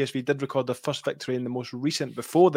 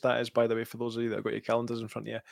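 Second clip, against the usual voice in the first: ordinary delivery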